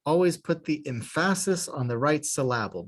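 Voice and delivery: second-syllable stress on both "emphasis" and "syllable"; misplaced stress. Shown as a demonstration. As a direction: deliberately say "emphasis" as em-FASS-is and "syllable" as sil-LAB-ul. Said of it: "Now you know what emphasis means".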